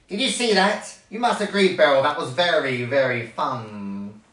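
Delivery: delighted villainous character voice; gloating wasp. A narrator's reading. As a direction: crow over the mischief, savoring the last words. Wasp gloating after mischief.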